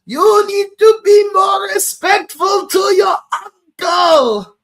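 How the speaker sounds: high, loud mock scolding yell; hammed-up Zuko shout. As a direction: yell at him like an angry young prince. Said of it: "I smack him in the head".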